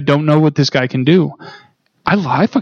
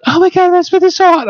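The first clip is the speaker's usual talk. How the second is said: much higher gushing fangirl voice; mock swooning fan girl. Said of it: "all the women who post online".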